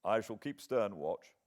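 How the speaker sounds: plain reading voice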